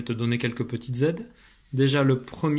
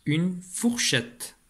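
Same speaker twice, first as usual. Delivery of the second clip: une fourchette said very slowly, a bit higher; slow model for a young reader; very slow model reading; 5 semitones higher, much slower than usual. Shown as a demonstration. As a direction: stretch every syllable of une fourchette. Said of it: "deux syllabes ici".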